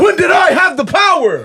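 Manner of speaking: high, loud movie-quote outburst; imitated yelling. Quoting the film's angry hero to co-hosts, yelling. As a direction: shout it high, like an outraged film character. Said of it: "when he starts yelling at the maid".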